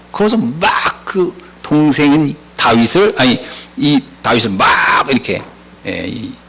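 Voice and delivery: ordinary talk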